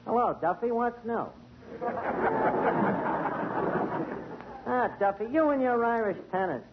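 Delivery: ordinary delivery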